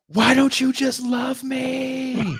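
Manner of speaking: high, slow, whiny mock wail; imitating his yell. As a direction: wail it like a desperate man begging. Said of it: "And he yells".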